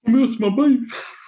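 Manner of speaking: high, slow, whiny lament; mock whine. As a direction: wail it like a sulking child. Whining about the salad dinner.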